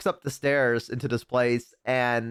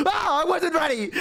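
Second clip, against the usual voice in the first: high-pitched re-enacted shriek of shock; hammed-up wail of being caught unprepared; mock panic; 13 semitones higher than usual. Re-enacting shock at parting curtains.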